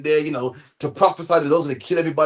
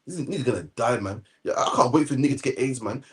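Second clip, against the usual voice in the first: smug inner thought; 6 semitones lower, 6 dB quieter than usual. Retold or imitated. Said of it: "and we think".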